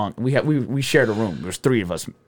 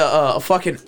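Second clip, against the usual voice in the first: ordinary delivery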